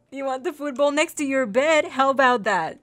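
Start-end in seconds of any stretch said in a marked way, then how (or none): none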